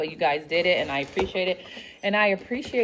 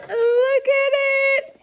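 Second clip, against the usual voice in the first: gasp, then much higher, slow squeal; delighted gasp; 8 dB louder, slower than usual. Real, not acted. Gasping at cute gift.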